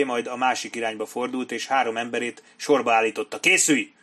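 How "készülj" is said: raised, higher military order; short loud command; shouted command. A narrator's reading. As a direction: shout them to readiness. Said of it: "kiáltotta".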